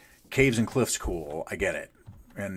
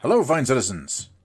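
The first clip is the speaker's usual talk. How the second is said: higher, louder grand greeting; pompous mayor; 6 semitones higher, 5 dB louder, faster than usual. Pretend. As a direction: announce yourself like a self-important mayor. Introduces himself as the mayor.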